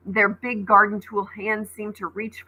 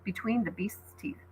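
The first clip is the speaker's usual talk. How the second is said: ordinary delivery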